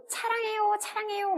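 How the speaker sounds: high-pitched 차랑해요 with ch for s; imitated foreign fans. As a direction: mimic a fan saying 차랑해요 for 사랑해요. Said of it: "시옷 발음을 잘 못하고".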